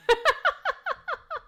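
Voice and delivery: very high laugh. Real, not acted. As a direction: shriek of laughter. Laughing at his joke.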